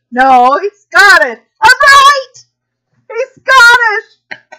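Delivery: high, loud mock insistence, cried out; insisting shout. Retelling arguing with Ronan over the accent, a loud high insistent cry. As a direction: insist you were right, loud and high. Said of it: "and I'm like".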